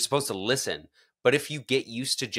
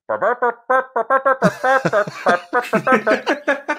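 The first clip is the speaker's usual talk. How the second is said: garbled announcement noise; 14 semitones higher, 8 dB louder than usual. Retold or imitated.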